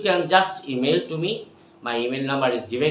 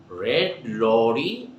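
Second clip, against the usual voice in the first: very slow, deliberate words; slow drill; 4 semitones lower, much slower than usual. Shown as a demonstration. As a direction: stretch it out for learners. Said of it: "So what I say".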